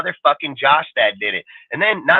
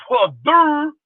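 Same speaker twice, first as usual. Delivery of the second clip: sarcastic duh; 10 semitones higher than usual. Acted. Sarcastic retort at critics.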